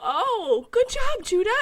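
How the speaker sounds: high, loud, gushing praise; hurried mock praise. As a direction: overdo the praise to fix it. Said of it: "And I was like".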